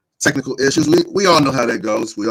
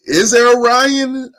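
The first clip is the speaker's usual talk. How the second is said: excited; 12 semitones higher, 6 dB louder, slower than usual. Real, not acted. Building hype list of fights.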